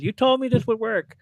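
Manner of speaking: high, indignant mock complaint; mock accusation. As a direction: whine it at the cousins. Accusing cousins.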